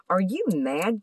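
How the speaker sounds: ordinary delivery, nothing marked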